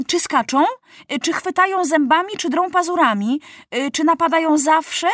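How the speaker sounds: put-on curious African girl Kluklu pressing for answers; Kluklu, eager questions. A narrator's reading. (ciekawska Kluklu, wysoki, żywy głos)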